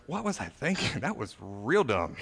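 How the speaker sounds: quiet, low rueful aside; regretful self-talk. Retold or imitated. Preacher voicing himself regretting a freak-out, quietly rueful. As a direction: mutter it to yourself, embarrassed. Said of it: "a day later being like".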